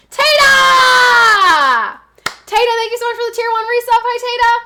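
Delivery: loud, high, excited shout. Real, not acted. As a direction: shout a happy welcome. Greeting a returning subscriber.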